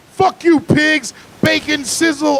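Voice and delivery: high, loud mock taunt, slow; yelling at cops. Angry bystander yelling at the cops, high and loud. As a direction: jeer like an eighth grader. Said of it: "I started yelling at the cops".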